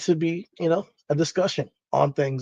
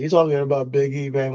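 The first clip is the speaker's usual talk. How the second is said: ordinary delivery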